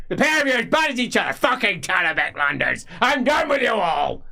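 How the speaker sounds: fast, high, loud outburst; fed-up old magister. Acted. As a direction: wash his hands of them both. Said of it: "Tuberman dismissing both".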